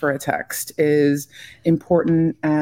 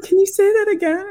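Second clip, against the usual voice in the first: mock eager ask; 3 dB louder than usual. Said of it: "He wants to be like".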